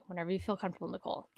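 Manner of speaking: softly quoted, easygoing encouragement; patient reassurance. Retold or imitated. Hunting partner telling the nervous shooter to take her time, soft and patient.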